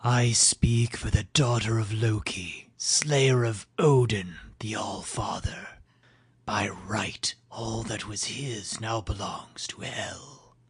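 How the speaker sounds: slow, high, unvoiced ghostly whisper; eerie whisper. Hel's messenger claiming Odin's realm, slow ghostly whisper. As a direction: hiss the claim like a ghoul.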